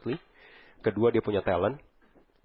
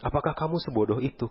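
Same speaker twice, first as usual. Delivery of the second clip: ordinary talk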